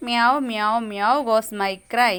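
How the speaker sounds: slow sing-song rhyme about a cat; poem recitation. Shown as a demonstration. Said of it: "title white കാറ്റ്". (കുട്ടിക്കവിത പോലെ ഈണത്തിൽ)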